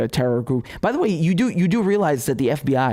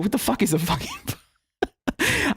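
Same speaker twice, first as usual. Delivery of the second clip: high, slow, cracking up mid-question; incredulous question dissolving into giggles; breaking into laughter; 9 semitones higher, 3 dB quieter, slower than usual. Retold or imitated. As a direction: laugh through the question until it falls apart. Cracking up at the odd name.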